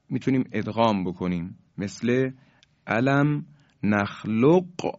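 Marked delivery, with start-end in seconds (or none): 2.9-4.9 نخلقکم with a distinct q before the k; qaf pronounced unmerged. Shown as a demonstration. Tajweed teacher showing radio listeners the unmerged qaf, q and k separate in نخلقکم. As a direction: slowly sound both q and k in نخلقکم. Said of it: "یعنی قاف ساکن هست".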